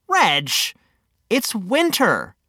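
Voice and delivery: plain reading voice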